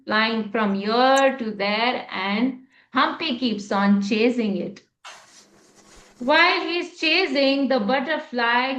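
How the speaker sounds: ordinary delivery, nothing marked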